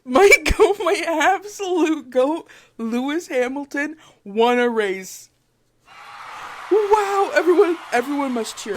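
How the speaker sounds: high, loud fan celebration; giddy, shouted hype; excited cheering. Real, not acted. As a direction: burst out cheering for your hero. Celebrating favourite driver's race win.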